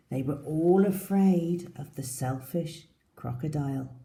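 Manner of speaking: lively read-aloud storytelling; story narration. Acted. Teacher reading the story to her class, lively. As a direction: read it to small children.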